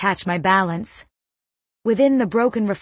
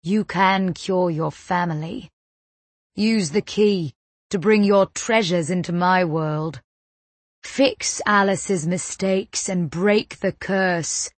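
put-on Cockney accent. A narrator's reading. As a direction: purr the words in Cockney. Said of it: "that Cockney accent I heard at the store".

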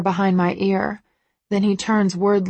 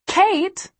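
high chat voice; 12 semitones higher than usual. A narrator's reading. Chat reading, Kate's voice.

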